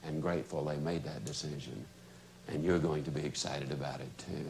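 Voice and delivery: very low, hushed, earnest sales pitch. Shown as a demonstration. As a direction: speak softly and deep, pausing between phrases. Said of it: "You lower your voice and say".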